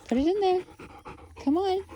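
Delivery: very high quick come-on to a puppy; high coaxing. Real, not acted. Owner coaxing Cora to try, very high. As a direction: lift the voice way up to coax. Teaching dog a trick.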